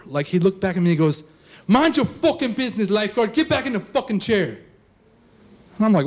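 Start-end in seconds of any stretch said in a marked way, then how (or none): 1.7-4.6 raised, higher-pitched angry order; menacing kid. Acted. Kid killer ordering the lifeguard back to his chair, menacing. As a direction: tell off the lifeguard, pitched up and forceful. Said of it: "It was just this dead killer".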